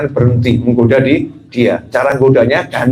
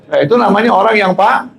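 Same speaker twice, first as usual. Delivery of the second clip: ordinary talk, nothing marked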